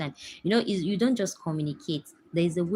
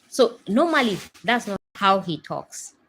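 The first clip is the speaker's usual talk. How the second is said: normal talking voice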